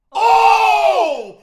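huge high-pitched shout of shock; real excited yell. Real, not acted. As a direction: yell it out at the game. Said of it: "we yelled that loud".

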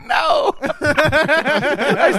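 a very high squeal of a no, cracking up; laughing no. Retold or imitated. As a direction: yelp the no through laughter. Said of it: "I go, I go".

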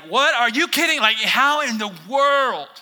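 high, loud disbelief; can't believe it; Mary reacting to Gabriel's news; incredulous Mary.

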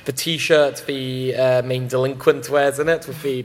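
ordinary talk

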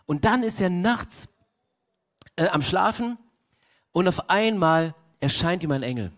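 ordinary talk, nothing marked

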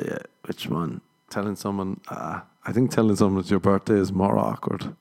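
normal talking voice

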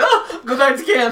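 high, slow, laughing whine; mock whine. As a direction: drag it out, laughing at yourself. Mocking his own in-game reaction.

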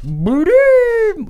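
very high, loud squeaky bloop; cartoon creature call. Grimace explains the rat ball, very high squeak. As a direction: pop it out like a goofy cartoon blob.

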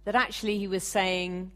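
ordinary delivery

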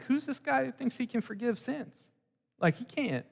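high, soft, incredulous muttering; scoffing scribes. Retold or imitated. Scribes arguing among themselves about Jesus, scoffing. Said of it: "going like".

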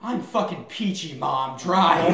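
sarcastic, ending in a loud bark on drive; sarcastic snap. Retold or imitated. Snapping at mom in the car.

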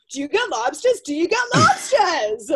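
put-on high, loud Boston shopper's accent; old-timey Boston accent. Shopper asking after lobsters, high Boston accent. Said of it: "the old timey Boston accent".

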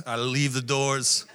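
ordinary delivery

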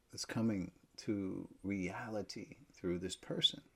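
usual speaking voice

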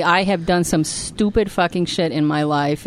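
normal talking voice

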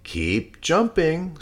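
teacher pig voice. Acted. Teacher pig instructs class.

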